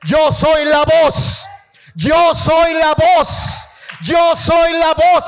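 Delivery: John the Baptist crying out his answer, rising to a shout; proclaiming. Acted. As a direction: build it up to a shout like the prophet. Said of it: "y empieza a decirles".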